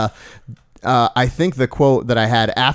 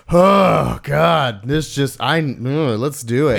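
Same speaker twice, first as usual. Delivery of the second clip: weary groan of a parent at night; exhausted parent; 4 semitones higher, slower than usual. Retold or imitated. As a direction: sigh it out like a worn-out parent. Friends imitating a tired parent.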